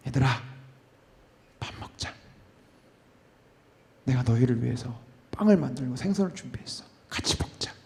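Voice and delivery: 숨소리로 부드럽게 속삭이듯; 아이 달래듯 조용히 불러 주기; 속삭이는 예수님. Acted. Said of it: "한마디 없어요". (속삭이는 다정한 목소리)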